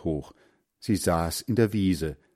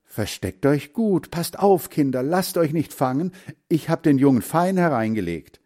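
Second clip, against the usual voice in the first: higher, urgent call to the brood; mother duck warning; 6 semitones higher, 4 dB louder than usual. A narrator's reading. Mother duck warns her hidden children, urgently calling. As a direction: warn them, then gloat a little. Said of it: "Das bedeutete".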